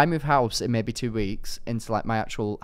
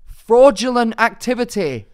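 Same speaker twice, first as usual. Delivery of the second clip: loud, high comic outrage; high-pitched, emphatic indignation; indignant rant; 9 semitones higher, 7 dB louder than usual. Real, not acted. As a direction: protest it like a scandal. Comic rant about mattresses sold separately.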